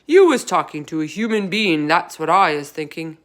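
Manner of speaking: loud, gruff accusation; bully him with it; loud accusing giant. A narrator's reading.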